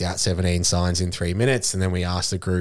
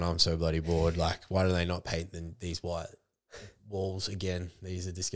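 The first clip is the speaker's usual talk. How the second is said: bored listener; 8 dB quieter than usual. Retold or imitated.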